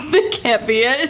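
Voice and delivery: usual speaking voice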